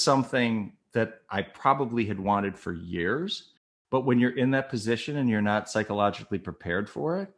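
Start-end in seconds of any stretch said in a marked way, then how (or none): none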